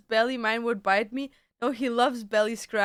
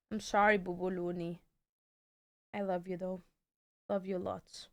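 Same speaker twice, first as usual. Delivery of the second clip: soft, low loving goodbye; tender to the cat; 4 semitones lower, 9 dB quieter than usual. Real, not acted. Streamer tenderly apologizing to her cat before leaving. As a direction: say sorry sweetly as you put the cat down.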